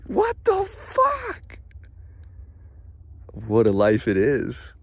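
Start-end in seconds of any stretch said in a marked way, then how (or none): 0.0-1.6 stunned disbelief. Real, not acted. High stunned.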